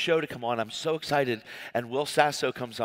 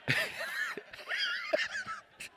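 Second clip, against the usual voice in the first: burst of laughter; 6 dB quieter than usual. Real, not acted. Laughs at his own story.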